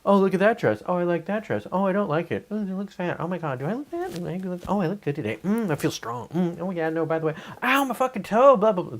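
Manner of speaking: high, fussy inner-voice chatter; a high, fretful self-talk voice. Retold or imitated. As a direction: voice the nagging little voice in your head. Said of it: "the little voice in our head that's like".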